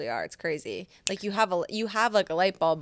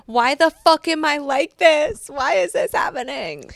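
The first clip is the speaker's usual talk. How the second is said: higher, louder, slow mock wail; imitating a desperate cry; 7 semitones higher, 9 dB louder, slower than usual. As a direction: replay the tearful phone call, hammed up. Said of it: "where I'm calling Amy crying".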